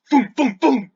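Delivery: three high, punchy booms; loud vocal rocket pops, one after another; imitated bolt launches. Game master imitating magical bolts shooting out, punchy sound effect.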